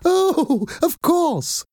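very high, delighted agreement; happy. A narrator's reading. Nanny accepting an interview, happy. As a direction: trill it out happily. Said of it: "in a happy voice".

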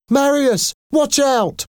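very high, urgent cry; cried warning. A narrator's reading. Eponine sees a gun aimed at Marius, crying out. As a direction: call out sharply high to warn him. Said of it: "she cried".

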